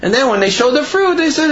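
usual speaking voice

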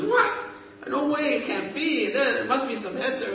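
wife can't believe her ears, voice shooting up; protesting in high-pitched shock; shocked disbelief. Retold or imitated. Wife shocked at rabbi's ruling.

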